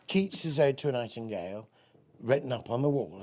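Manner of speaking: usual speaking voice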